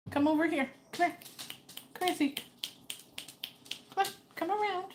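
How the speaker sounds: high, sing-song pet call; coaxing a dog. Real, not acted. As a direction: call the dog over in short, high bursts. Coaxing confused dog round the table.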